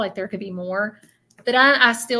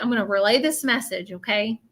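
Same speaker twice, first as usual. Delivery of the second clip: ordinary talk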